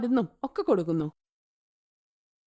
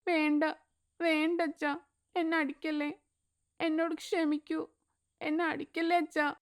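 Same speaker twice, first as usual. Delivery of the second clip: put-on softer, slightly higher pleading child; frightened girl; 4 semitones higher, 6 dB quieter than usual. Little girl begging her father not to beat her. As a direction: beg him not to hit you. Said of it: "Nirosha പേടിച്ചു പോകുന്നു".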